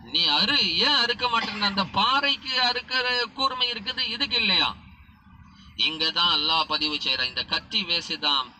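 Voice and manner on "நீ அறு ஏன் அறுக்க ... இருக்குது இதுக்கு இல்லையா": higher, louder pleading rebuke to the knife; father scolding the knife, high and insistent; exasperated demand. Acted. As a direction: demand why the knife won't cut. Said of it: "கத்தி இடத்துல பேசினாங்களாம்".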